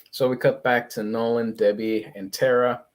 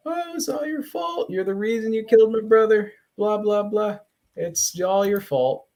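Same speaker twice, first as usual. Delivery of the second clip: high-pitched mock comic-book blame; mock accusation; 10 semitones higher than usual. Parodying two heroes' fighting conversation, mock accusing.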